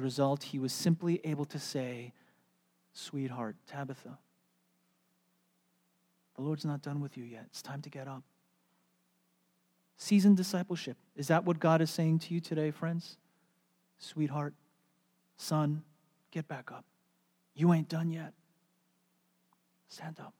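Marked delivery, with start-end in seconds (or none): 2.9-8.2 soft, half-whispered wake-up; tender hush. Retold or imitated. 14.0-20.3 soft, gentle encouragement; hushed tender plea. Retold or imitated. God speaking tenderly.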